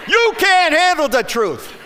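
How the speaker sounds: loud, high movie-line shout; playful yell. Acted. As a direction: yell it at the pastor with mock outrage. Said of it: "yell at the pastor".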